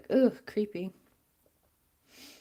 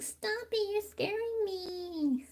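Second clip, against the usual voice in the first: high, soft, slow frightened little voice; high soft mock-frightened kid; scared kid; 11 semitones higher, 6 dB quieter, much slower than usual. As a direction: whimper like a scared boy. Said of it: "and he was like".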